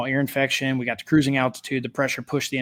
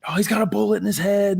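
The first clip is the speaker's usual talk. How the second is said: high-pitched mock panic; freaking out; 7 semitones higher than usual. Friend alerting a flight attendant, imitated high-pitched freak-out. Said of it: "he's kinda freaking out".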